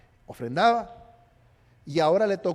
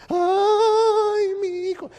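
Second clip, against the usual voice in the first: high, slow, hammed-up wail of a weeping mother; mock wailing; 12 semitones higher, 7 dB louder, much slower than usual. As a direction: wail it like la Llorona, far over the top. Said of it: "de ahí salió la llorona". (llanto exagerado y agudo de madre, como la Llorona)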